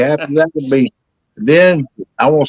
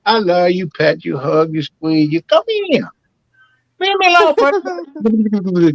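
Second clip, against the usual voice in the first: much higher, slow, mock sweet-talk; hammed-up squeaky flirt; 7 semitones higher than usual. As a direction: squeal it like a lovesick clown. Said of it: "continues the flirt, very high".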